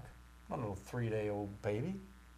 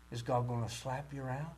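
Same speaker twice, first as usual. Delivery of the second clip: ordinary talk, nothing marked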